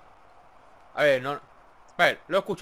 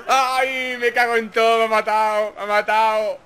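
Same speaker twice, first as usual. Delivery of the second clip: frustrated shout; 4 dB louder than usual. Real, not acted. Just killed in game.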